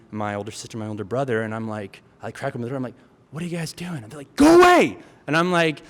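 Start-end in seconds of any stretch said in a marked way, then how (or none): 3.3-4.0 轻声询问; softly peek in and ask; hushed question. Retold or imitated. Peeking in on siblings. 4.3-5.0 high, loud dismissal; shooing yell. Retold or imitated. Older siblings shoo him off.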